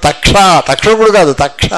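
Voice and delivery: ordinary talk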